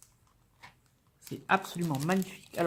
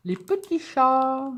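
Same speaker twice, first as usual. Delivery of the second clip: high, loud, drawn-out cooing; gushing delight; 8 semitones higher, 8 dB louder, much slower than usual. Real, not acted. Vlogger showing kitten stamps to viewers, gushing. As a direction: coo it slowly, melting over something cute.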